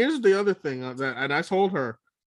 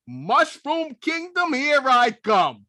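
high, slow cartoon-hero imitation; Mario; 10 semitones higher, much slower than usual. Quoting Mario's line to friends, high drawn-out imitation. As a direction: stretch it out in a high character voice. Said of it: "when I heard him say".

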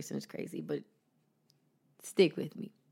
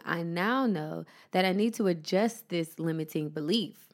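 usual speaking voice

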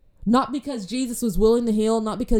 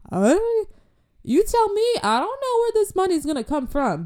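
high excited pitch; 10 semitones higher than usual. Retold or imitated. Retelling her own excitement.

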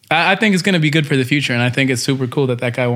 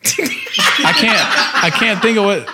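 laughing while talking; cracking up mid-sentence; 6 semitones higher than usual. Real, not acted. Breaks character laughing.

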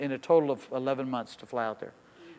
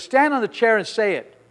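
raised-voice command, imitating a demanding mentor; retold stern order; 5 semitones higher, 5 dB louder than usual. Pastor orders him onto a restaurant chair, retold stern order. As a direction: order him up, louder and higher.

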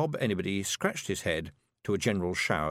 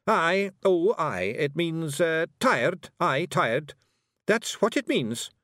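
high, halting Scots explanation; hasty covering-up Feegle; 7 semitones higher, 4 dB louder, much slower than usual. A narrator's reading. Rob explaining away his brother's rude word, flustered.

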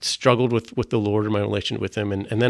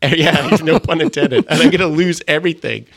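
real laugh, louder and higher, through the words; 7 semitones higher, 7 dB louder than usual. Real, not acted. Guest laughing at the host's pun, louder and laughing. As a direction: laugh along at the pun while finishing the thought.

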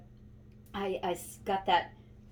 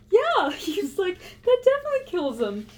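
mock exasperated grandpa; 10 semitones higher, 7 dB louder than usual. Grandpa confirms trees are dead.

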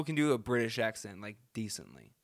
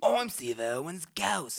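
slow, higher Australian ghost; Steve Irwin; 5 semitones higher, slower than usual. Acted. Host echoes the ghost joke, slow and raised Australian. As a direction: drag out a hammy Australian accent.

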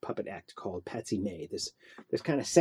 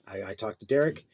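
ordinary talk, nothing marked

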